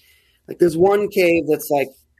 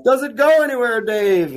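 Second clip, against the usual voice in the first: higher-pitched mock holler from above; pitched-up, stretched-out mock call; retold yell; 7 semitones higher than usual. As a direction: imitate calling down to someone. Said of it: "and he's yelling".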